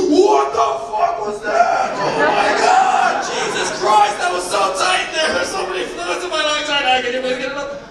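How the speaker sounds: put-on high, shrieking shocked baby voice; panicked newborn. Comic voicing himself as a newborn, shrieking in panic. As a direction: freak out like you just got born. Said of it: "this is how it went".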